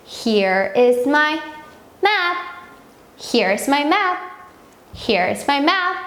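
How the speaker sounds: very slow, clear teacher demo; slow model sentence. Shown as a demonstration. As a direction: stretch each word out for the kids. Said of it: "여기 내 지도가 있어". (아주 천천히 또박또박)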